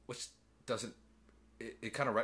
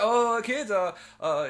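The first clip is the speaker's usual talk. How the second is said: startled voice; 11 semitones higher, 8 dB louder, slower than usual. Retold or imitated. Goldblum's surprised reply.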